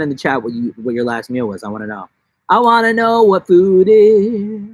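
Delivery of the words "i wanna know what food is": louder, higher, slow; drawn-out loud demand; loud slow plea. Acted. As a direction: belt it out to the chat.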